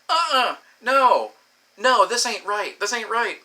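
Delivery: loud, high, drawn-out mock refusal; upset protest. Upset woman telling her lover it isn't right, retold loud high protest. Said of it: "she's legitimately upset".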